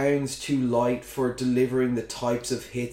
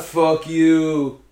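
louder, higher taunt at the crowd; mock defiant shout; 4 semitones higher, 8 dB louder than usual. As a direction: throw it back at the booers. Acted gesture toward booing crowd.

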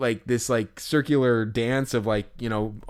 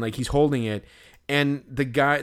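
normal talking voice

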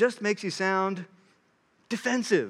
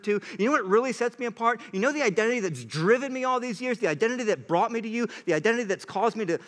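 quick and pressing, higher; fast, insistent preacher voicing Paul; urgent challenge; 5 semitones higher, faster than usual. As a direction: rattle off the questions, building fervour. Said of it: "He's like".

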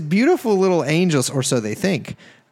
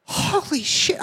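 shocked whisper; 11 semitones higher, 5 dB quieter, slower than usual. Acted.

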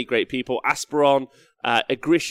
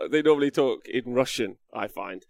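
usual speaking voice

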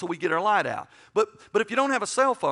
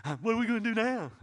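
mock wimpy whimper, pitched up; high, put-on wimpy voice; wimp; 5 semitones higher, 3 dB quieter, faster than usual. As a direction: imitate a big guy gone helpless. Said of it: "then he turned into a wimp".

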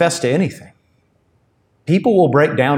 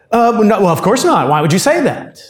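higher and louder, flustered over-insistence; suspicious emphatic denial; 8 semitones higher, 4 dB louder than usual. Shown as a demonstration. Peter at the gate, denying to the servant girl, flustered and emphatic. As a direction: protest far too much, like a man who's been caught. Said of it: "kind of suspicious, very emphatic".

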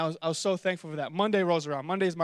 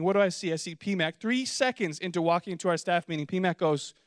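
ordinary delivery

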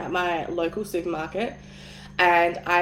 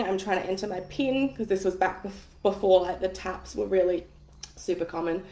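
ordinary delivery

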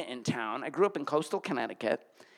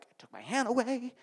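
ordinary delivery

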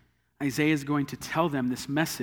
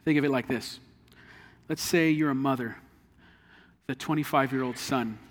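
ordinary delivery

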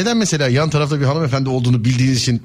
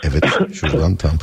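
ordinary delivery

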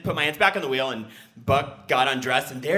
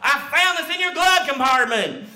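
stern cop; 13 semitones higher, 7 dB louder than usual. Retold or imitated. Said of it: "And he goes".

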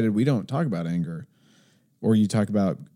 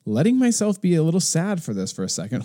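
higher, heated retold protest; mock exasperated self; 6 semitones higher than usual. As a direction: push back sharply. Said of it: "I'm like".

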